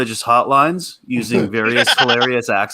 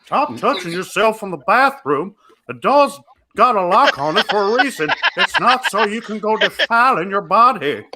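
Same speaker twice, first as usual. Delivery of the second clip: higher, twangy dad scolding Bobby; Hank Hill voice; 9 semitones higher than usual. Pretend. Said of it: "Damn it, Bobby".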